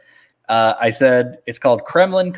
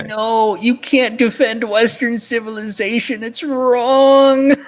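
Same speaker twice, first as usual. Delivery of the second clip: mocking outraged critic; 11 semitones higher, much slower than usual. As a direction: parody a shrill offended critic.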